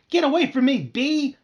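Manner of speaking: high, loud panicky yell; frightened yell. Acted. Sister yelling at a bee while thrashing her hands. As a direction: shoo the bee off, shrieking.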